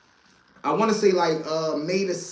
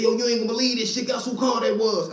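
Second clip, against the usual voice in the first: fast and higher, bursting with it; excited news; 6 semitones higher, faster than usual. Retold or imitated. Mark phoning from jail with crazy news, fast excited rush. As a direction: rush it out like you can't hold the news in.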